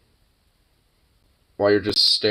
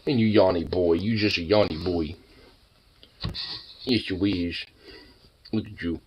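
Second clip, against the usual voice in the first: doting pet talk; 5 semitones lower, 4 dB quieter than usual. Real, not acted. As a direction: coo lower at your sleepy dog. Cooing to the dog.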